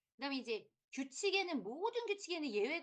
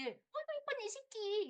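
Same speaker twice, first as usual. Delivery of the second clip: doting coo; 11 semitones higher than usual. Acted. Teacher cooing at clingy pupils.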